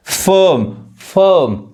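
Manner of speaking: फर्म as "fuhm", no r; silent r. Shown as a demonstration. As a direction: drop the r in फर्म. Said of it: "आर नहीं पढ़ाता है".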